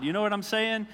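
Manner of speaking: ordinary talk, nothing marked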